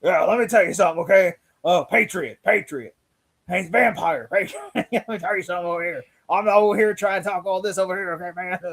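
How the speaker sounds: blustering mock radio ranter; Alex Jones. Riffing for laughs on the villain's talk, mock ranting. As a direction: ramble on as a worked-up ranting host. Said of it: "he sounds like Alex Jones when he talks".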